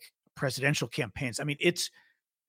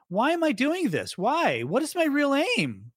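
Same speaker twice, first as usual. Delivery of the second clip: much higher, wavering mock-old-man voice; mock senile Biden; 10 semitones higher than usual. Host mocking the campaign tweet as senile Biden. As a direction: ham up a confused old man. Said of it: "try make him look like he's senile".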